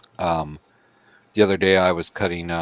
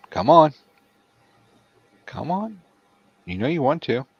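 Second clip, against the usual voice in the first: high coaxing voice; 9 semitones higher, 6 dB louder than usual. Real, not acted. Coaxing the cat.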